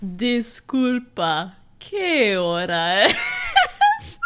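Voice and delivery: hammed-up slow language-tape mimicry; very slow mock repeat, rising into a laugh; exaggerated slow imitation. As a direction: drag every syllable out, breaking into laughter at the end. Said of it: "they legit say it slower the second time".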